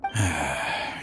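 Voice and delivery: a long, weary sigh before confessing; heavy sigh. Acted. Old man sighs before confessing to Cyno, weary. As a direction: let out a tired, resigned breath.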